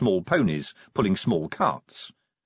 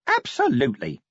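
eager imp; 4 semitones higher than usual. Acted.

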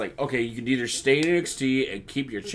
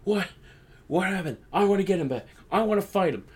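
mock outraged, pitched higher; lightly hammed indignation, higher voice; outraged Sheamus; 7 semitones higher, faster than usual. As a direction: play it up as the riled-up brawler demanding a fight. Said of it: "He's like".

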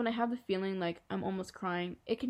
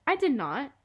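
higher, louder protest; indignant denial; 5 semitones higher, 6 dB louder than usual. Acted. Julian denies he misspoke, indignant. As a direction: deny it with a jump in pitch.

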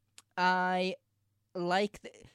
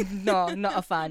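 ordinary talk

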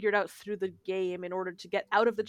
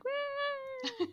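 retold cheer; 8 dB quieter than usual. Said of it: "I got excited".

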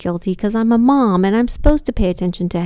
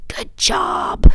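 mock congratulation in a drawn-out whisper; hushed, sarcastic pat on the back; sarcastic self-praise; 9 semitones higher than usual. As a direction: whisper fake praise to yourself.

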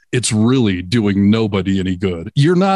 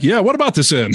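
higher, playful mock line; mock teasing quote; 9 semitones higher than usual. Host jokingly voices the driver repeating the passenger's word, pitched up. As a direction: tease him with a hammed-up voice. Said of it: "Did you repeat it back".

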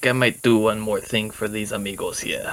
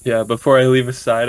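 ordinary talk

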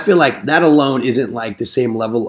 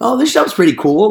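higher, eager new-guy voice; naive enthusiasm; 10 semitones higher, 4 dB louder than usual. Retold or imitated. Rookie salesman on his second day, eager excited. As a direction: gush like a rookie on day two. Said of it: "I was like".

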